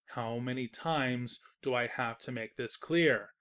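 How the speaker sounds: slow, drawn-out exasperated insistence; exasperated narrator losing patience. Acted. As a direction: spell it out wearily.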